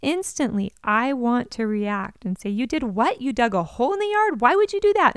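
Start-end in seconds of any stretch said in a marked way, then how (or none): 2.5-5.2 rising into a loud, high outraged yell; mock parent losing it, building to a shout; angry parent reaction. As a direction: blow up at the kid over the hole. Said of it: "storm out back ... demanding".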